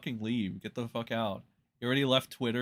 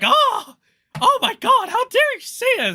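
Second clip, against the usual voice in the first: shrieking falsetto, much higher and louder, clutching pearls; mock outraged shriek; 13 dB louder than usual. Mocking Nux's offended reaction as a shrieking falsetto. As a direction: squeal it like an offended drama queen. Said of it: "all of a sudden you're like".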